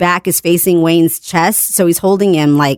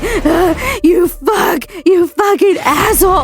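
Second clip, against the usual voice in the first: high, anguished cursing shout; reenacting a winded, sobbing tirade; breathless crying; 12 semitones higher, slower than usual. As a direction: wail the insults in a much higher pitch. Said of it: "I can't breathe".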